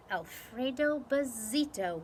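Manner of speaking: ordinary delivery, nothing marked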